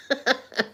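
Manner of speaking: short, high real chuckle; laugh. Real, not acted. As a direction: chuckle at yourself briefly. Laughs at her own dates.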